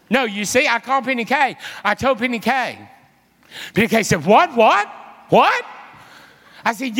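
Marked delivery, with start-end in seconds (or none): 4.2-5.7 high, rising mock shock; louder each what shooting upward in disbelief; startled disbelief. Friend hearing wild news on the phone, imitated startled disbelief.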